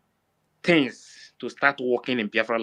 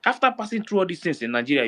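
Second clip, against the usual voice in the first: ordinary talk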